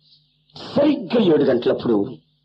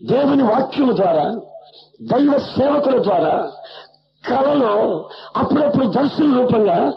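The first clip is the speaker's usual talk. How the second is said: higher, slow preaching with rising fervor; slow, fervent sermon appeal, pitched higher; 8 semitones higher than usual. Acted. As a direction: call out to the congregation slowly and higher. Preaching climax.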